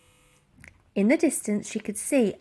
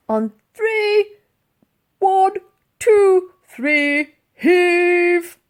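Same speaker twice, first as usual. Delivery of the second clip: high, loud shouted countdown; loud count; 8 semitones higher, 8 dB louder, much slower than usual. Acted. A pensioner counting the crew to heave, shouting. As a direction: drill the crew through the count.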